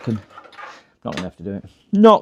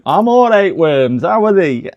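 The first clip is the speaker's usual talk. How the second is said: louder, hammed-up Yorkshire accent; 6 semitones higher, 6 dB louder, slower than usual. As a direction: answer like a bluff Barnsley lad. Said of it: "He's suddenly got really Barnsley, uh, Yorkshire accent".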